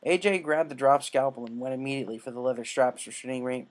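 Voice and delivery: plain reading voice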